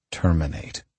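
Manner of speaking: ordinary delivery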